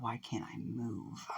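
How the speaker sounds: slow, whispered disbelief; hushed bewilderment. Acted. Shigaraki to himself, suddenly frozen, in a slow whisper. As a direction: whisper it slowly, barely voiced.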